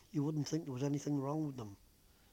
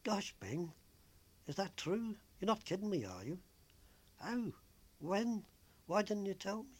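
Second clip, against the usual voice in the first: rising, higher surprised questions; 7 semitones higher than usual. A narrator's reading. Joyce surprised by Bing's news, voice rising higher. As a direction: gasp out the questions in happy surprise. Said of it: "got surprised".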